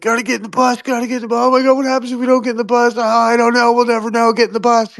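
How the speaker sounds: high, fast frantic mock panic; hammed-up doomsayer herding people onto the bus; panicky alarmist. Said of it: "And they're like".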